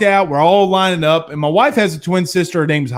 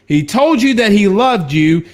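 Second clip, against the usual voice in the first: normal talking voice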